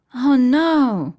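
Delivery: put-on startled gasp of a girl; surprised. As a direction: react with sudden alarm. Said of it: "Wendy is very surprised".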